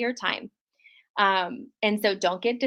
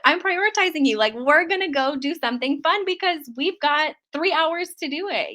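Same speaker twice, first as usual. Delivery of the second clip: excited; 6 semitones higher than usual. Shown as a demonstration. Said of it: "you don't have to say it like this".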